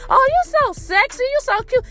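high, sing-song fawning voice; mocking a thirsty flirt.

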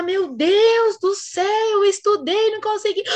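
high, loud, slow mock despair; drawn-out hammed-up Meu Deus do céu. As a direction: wail it like a panicked student. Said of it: "você fala".

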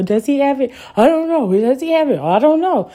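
a hammed-up nervous second-guess; anxious doubting. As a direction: play the worried doubter flipping back and forth.

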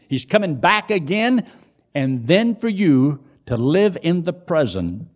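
high, loud, driving sermon delivery; full-force preaching. Real, not acted. Preacher challenges believers who live as though God is dead, preaching forcefully. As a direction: preach it out, hammering each phrase.

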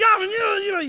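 high, loud garbled ranting; mock a furious boy sputtering; mad kid. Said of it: "he's just all mad".

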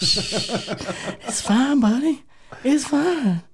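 high, sing-song reassurance; cooing to a scared pet. Pretend. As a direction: coo at it like a nervous puppy. Soothing the frightened red block.

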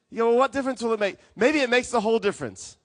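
loud, high, impassioned sermon delivery; forceful preaching. Real, not acted. Preacher answers an objection.

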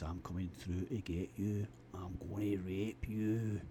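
slow, menacing talking horse. Retold or imitated. Horse at the bedroom window threatening the narrator, slow and menacing. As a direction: creep the words out slowly like a sinister horse.

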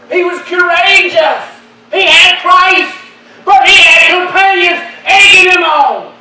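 loud, high climactic shouting; preaching at full force. Acted. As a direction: whoop it up to the church.

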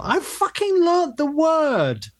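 high, slow, exasperated outburst; acting out a restaurant row; indignant insistence. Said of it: "we had a big fight and a scrap".

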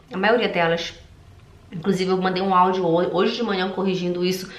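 usual speaking voice

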